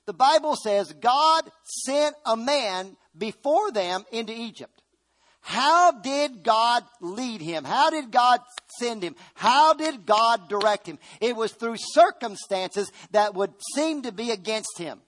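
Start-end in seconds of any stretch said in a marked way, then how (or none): 0.1-11.0 slow, loud, high preaching cadence; preaching at full force. Acted. Preacher to congregation, slow rising sermon cry. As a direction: hammer each question out to the church.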